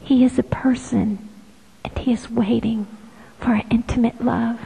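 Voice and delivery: soft unvoiced whisper, a touch quick; prayerful whisper. Speaker whispering to listeners about Jesus's love, prayerful whisper. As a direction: breathe the words like a secret prayer.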